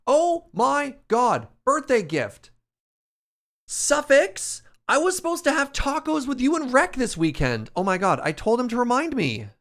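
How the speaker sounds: high, loud oh-my-god panic; suddenly remembering a missed birthday; real shocked realization. Real, not acted. Realizes she forgot friend's birthday.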